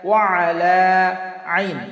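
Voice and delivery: ওয়া আলা আইন with a clear throaty ain, slow; ain sounded. Shown as a demonstration. Teacher models the ain sound for students, slow and careful. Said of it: "এই জন্য আমরা এখানে খেয়াল রাখবো".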